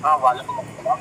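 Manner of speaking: caller on a bad phone line; unclear, muffled phone audio. Real, not acted. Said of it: "आपकी आवाज़ साफ नहीं आ रही".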